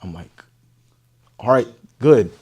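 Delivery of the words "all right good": louder, pumped; nervous bravado. Retold or imitated. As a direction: steel yourself loudly. His nervous reply.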